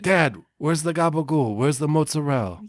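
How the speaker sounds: put-on New Jersey Italian accent. Jersey Italian kid impression.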